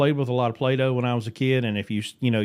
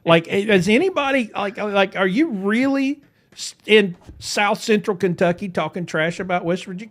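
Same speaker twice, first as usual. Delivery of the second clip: incredulous rant; 8 semitones higher than usual. Retold or imitated. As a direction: stretch it out in mock outrage. Mocking a West Virginia-basher.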